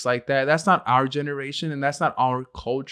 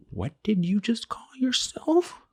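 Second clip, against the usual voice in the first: slow, unvoiced threat; menacing whisper; 7 semitones higher, slower than usual. Dad whispering a threat at his son. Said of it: "I'm like".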